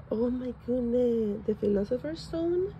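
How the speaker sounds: usual speaking voice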